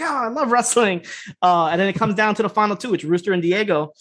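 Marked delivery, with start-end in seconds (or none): 0.0-1.0 higher-pitched imitated delight; hammed-up adoring sigh of enthusiasm; mock gushing fans. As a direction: gush like a new fan, half-joking. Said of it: "They're just loving all this shit".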